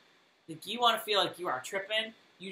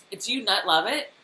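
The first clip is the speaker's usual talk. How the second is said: ordinary talk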